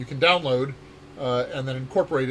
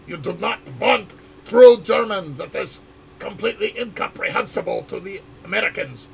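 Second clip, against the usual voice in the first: hammed-up, higher German accent; fake German accent; 5 semitones higher, 7 dB louder than usual. Shown as a demonstration. Developer showing why true German would confuse Americans, thick German accent. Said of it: "you want that fake German accent".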